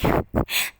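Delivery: loud breathy laugh; a friend laughing loudly at a joke; burst of laughter. Acted. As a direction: laugh out loud before speaking. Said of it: "तारा कहती laughs".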